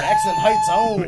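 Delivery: very high, slow put-on voice; movie voice. Quoting the movie to the co-hosts in a very high slow voice. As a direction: squeak it out slowly like the character.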